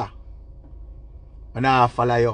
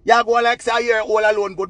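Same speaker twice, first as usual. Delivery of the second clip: usual speaking voice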